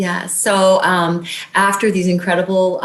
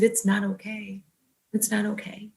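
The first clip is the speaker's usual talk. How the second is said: soft, hushed tearful protest; retold sobbing; 3 semitones higher, 10 dB quieter than usual. Patient answering her doctor's reassurance, retold soft sobbing. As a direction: quietly re-enact protesting through tears, sinking softer.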